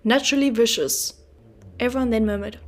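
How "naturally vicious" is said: put-on short, curt old man's verdict; terse verdict. Mr Hubble tersely condemning the boy at dinner, curt. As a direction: clip it off like a final judgement. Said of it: "tersely solved it".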